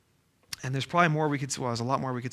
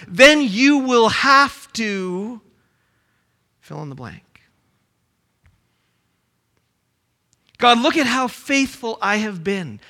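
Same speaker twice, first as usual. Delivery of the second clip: bargaining believer; 4 semitones higher, 8 dB louder than usual. Acted.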